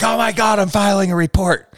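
very high, loud shocked woman's voice; outraged coworker. Retold or imitated. Amy snapping at the creepy coworker, high mock outrage. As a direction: shriek it like she's appalled. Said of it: "And she's like".

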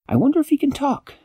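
high curious character voice. A narrator's reading. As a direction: sound like an eager small helper. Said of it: "character line".

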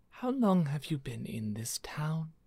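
lightly voiced woman, soft and breathy; a hint of a hushed lady; Lady Dave's voice. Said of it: "supposed to still be doing Lady Dave's voice".

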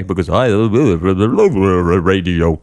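mock radio-presenter delivery. Tour guide joking about why he speaks this way, radio voice. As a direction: put on an exaggerated on-air voice. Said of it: "that's why I speak this way".

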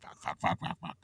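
breathless whispered curses under the breath; a string of hushed curses; whispered swearing. Retold or imitated. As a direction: mutter the swears in a hush. Retold frustrated group texting.

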